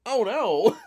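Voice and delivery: high, loud, drawn-out alarm; hammed-up horrified realization; mock dismay. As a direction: play a goofy visitor suddenly alarmed. Acts Ted's sudden discovery.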